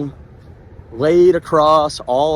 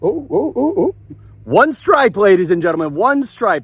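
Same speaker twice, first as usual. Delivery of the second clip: excited reveal; 6 semitones higher, 4 dB louder than usual. Real, not acted. Reading covid test reveal.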